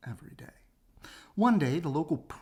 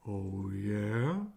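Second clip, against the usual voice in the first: slow tortoise; 5 semitones lower than usual. Acted. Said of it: "said Tortoise slowly".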